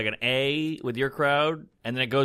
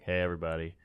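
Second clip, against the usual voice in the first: quiet; 6 semitones lower, 6 dB quieter, faster than usual. Retold or imitated. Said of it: "you just got quiet".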